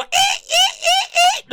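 very high squealing eeks; shrieking psycho. Acted. Killer jumping from the tub, very high shrieking eek. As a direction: shriek like a slasher leaping out. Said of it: "jump out and go".

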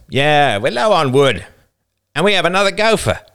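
put-on high, loud rough boy; casual menace; older boy voice. A narrator's reading.

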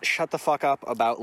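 ordinary delivery

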